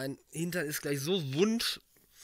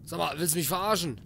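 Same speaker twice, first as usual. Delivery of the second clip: annoyed; 5 semitones higher than usual. Real, not acted. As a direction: tell off the creepy doll. Puppet moves, he scolds it. (genervt, lauter schimpfend)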